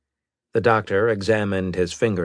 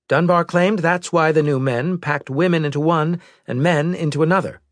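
higher-pitched, louder officer's voice; intelligence officer; 11 semitones higher, 6 dB louder than usual. Acted. Officer briefing the admiral on a survivor, higher-pitched report voice. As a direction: deliver a formal report in a raised pitch.

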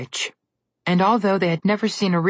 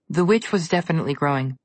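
ordinary delivery, nothing marked

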